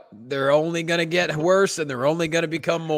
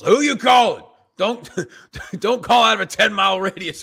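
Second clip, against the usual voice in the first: high, loud mock-grumpy dad; imitating his dad; 7 semitones higher, 6 dB louder than usual. Mimicking dad scolding kids over long distance calls, loud and high. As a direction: do a cranky dad fussing over the phone bill. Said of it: "My dad used to bitch about long distance calls".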